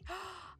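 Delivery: sharp high excited gasp. Acted. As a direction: gasp in delight. Layla reacts to Lilith news.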